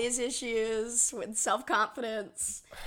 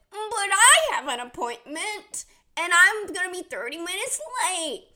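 whiny; 8 semitones higher, 5 dB louder than usual. Retold or imitated. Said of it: "and I said".

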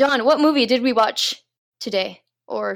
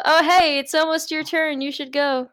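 higher, bright lifeguard call; 6 semitones higher than usual. Retold or imitated. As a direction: call them up like a cheery lifeguard. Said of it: "The lifeguard's like".

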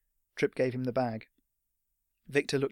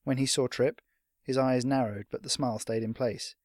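ordinary delivery, nothing marked

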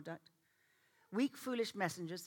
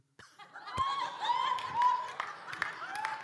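high laughing shriek; 5 dB louder than usual. Real, not acted. Laughing at her own story.

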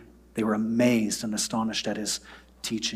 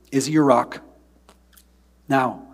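ordinary talk

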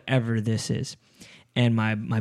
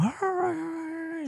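high, drawn-out dog yelp; soft, high-pitched howling cry; imitated dog yelping; 7 dB quieter than usual. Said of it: "It was yelping. It was crying".

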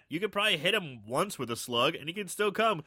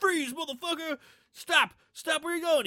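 high yelling police officer; shouting cop; 11 semitones higher than usual. Retold or imitated. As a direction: bark orders like a panicked cop. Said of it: "out comes Charlie".

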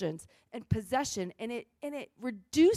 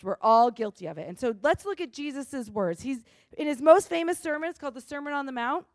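usual speaking voice

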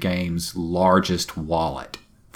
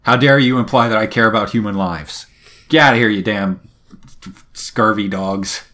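hammed-up outraged captain, louder; mock indignant tirade; 2 semitones higher, 5 dB louder than usual. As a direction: scold the crew in playful fake fury. Tetra's mock tirade at crew.

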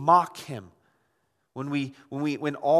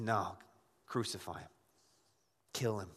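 much lower and softer retold crowd voice; low, quiet demand; 8 semitones lower, 12 dB quieter than usual. Crowd answering Pilate, low and hushed. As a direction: drop right down for the mob's cold reply.

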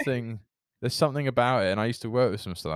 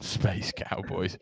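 low, slow, softer Scottish-sounding accent; American-to-Glaswegian trick; 5 semitones lower, 7 dB quieter, much slower than usual. Shown as a demonstration. Demonstrating the accent trick to a co-host, Scottish accent. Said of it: "you sound like a Glaswegian".

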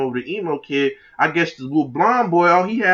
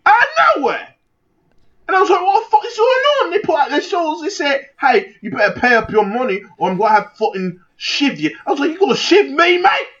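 high-pitched hammed-up British yelling; panicked mock-British, pitched way up; terrible British accent; 11 semitones higher than usual. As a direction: squawk like an outraged cartoon Brit. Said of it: "This is terrible accent".

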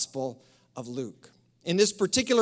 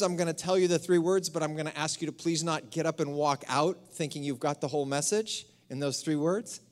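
ordinary talk

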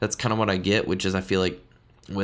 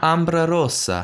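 "Ambra Rosa" in a high, louder, slow hammed-up Italian accent, rolled r; fancy Italian; 8 semitones higher, 4 dB louder, slower than usual. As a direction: show off with an over-the-top Italian "Ambra Rosa". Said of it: "I just wanted to sound really fancy there".

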